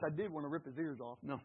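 ordinary talk, nothing marked

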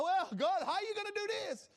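high, pleading mock question to God; high fretful plea to God; mock worried believer. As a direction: play the fretting believer, fast.

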